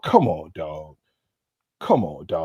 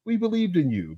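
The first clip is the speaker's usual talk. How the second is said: 1 higher; 8 semitones higher than usual. Real, not acted. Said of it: "repeated line".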